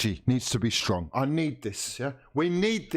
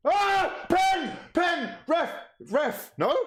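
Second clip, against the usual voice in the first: penalty appeal; much slower than usual. Real, not acted. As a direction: scream for the penalty.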